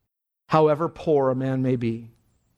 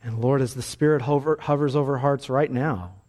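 prayer; 4 semitones lower than usual. Acted. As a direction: pray it gently over the congregation. Closing prayer.

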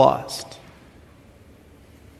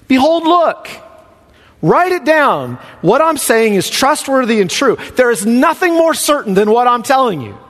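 proclaiming God's words; 7 semitones higher than usual. Acted. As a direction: ring out God's promise from the pulpit. Preacher voices God's promise.

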